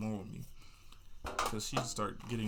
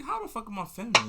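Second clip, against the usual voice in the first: indignant disbelief; 10 semitones higher, 6 dB louder than usual. Retold or imitated. Outraged at being called feminine.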